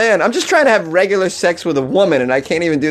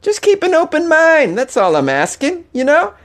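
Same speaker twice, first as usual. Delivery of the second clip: high, smarmy coaxing voice, much higher; sleazy boyfriend; 4 semitones higher than usual. Pretend. Boyfriend urging girlfriend to keep an open mind, high smarmy coaxing. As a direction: coax her like a creepy salesman. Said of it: "He's like".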